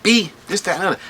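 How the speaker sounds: high, loud angry yell at her; retold yelling. Friend yelling at the officer girlfriend, loud and high. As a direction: bark it at her like he's losing it.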